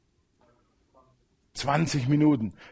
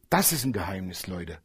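hushed confiding; 9 semitones lower, 9 dB quieter than usual. Acted. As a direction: drop low and soft, like sharing a secret. Confiding secret to congregation. (leise und vertraulich zur Gemeinde)

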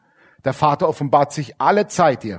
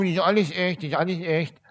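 softer, put-on boastful teen voice; mocking teen girls; 6 dB quieter than usual. Preacher mocks teens with surgery claiming it's natural, softer put-on voice. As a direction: mimic a girl insisting it's all real. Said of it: "laufen dann auch rum".

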